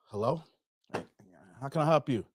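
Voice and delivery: low, soft, wary door answer; reluctant homeowner. Acted. Dave answering a Saturday morning knock, low and reluctant.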